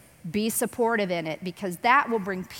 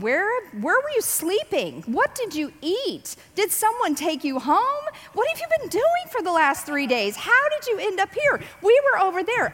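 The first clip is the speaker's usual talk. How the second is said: high, rising worried questions; anxious parent firing questions, pitch climbing; frantic mother; 8 semitones higher than usual. Retold or imitated. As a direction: pepper him with questions like a panicked mom. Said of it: "I'd be going".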